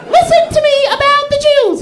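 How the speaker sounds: squeaky voice, very high and loud; real high voice. Pretend. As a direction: Hitler as a squeaky helium speech. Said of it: "a real high voice".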